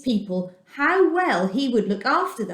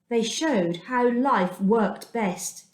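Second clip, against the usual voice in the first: ordinary delivery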